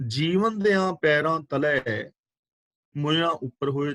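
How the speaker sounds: slow, measured recital of scripture; slow verse recitation. Acted. Preacher reciting Farid's verse to the congregation, slow and reverent. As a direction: intone each phrase slowly and reverently.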